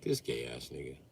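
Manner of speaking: low, soft grumbling under breath; annoyed dad muttering about son; muttered grumble. Retold or imitated. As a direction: grumble it to yourself. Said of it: "I be like".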